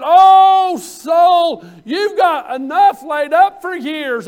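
loud, high crowing to his soul; rich man's boast. Retold or imitated. Preacher voicing the rich man boasting to his soul, loud and high. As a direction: boast it out, swelling with pride. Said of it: "he said".

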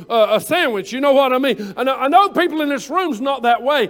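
ordinary talk

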